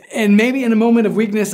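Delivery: normal talking voice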